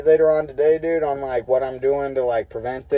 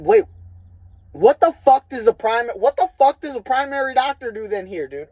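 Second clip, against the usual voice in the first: higher, louder disbelieving demand; mock-outraged question at the staff; incredulous outburst; 9 semitones higher, 3 dB louder than usual. Frustrated patient quizzing doctor.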